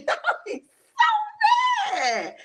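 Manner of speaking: very high, drawn-out squeal through laughter; laughing exasperation. Real, not acted. Viewer confessing outrage at the show, laughing squeal. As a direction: stretch it out slowly, cracking up. Cracks up over own mock outrage.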